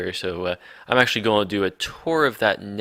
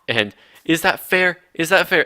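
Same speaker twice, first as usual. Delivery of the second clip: indignant; 8 semitones higher than usual. Real, not acted. Indignant complaint about being shot.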